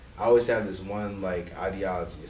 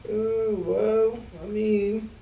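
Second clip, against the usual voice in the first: clueless teacher; 11 semitones higher, much slower than usual. Retold or imitated. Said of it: "I'm gonna be like".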